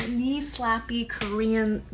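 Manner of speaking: normal talking voice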